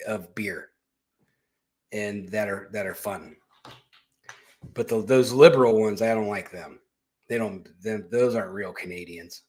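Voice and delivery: ordinary delivery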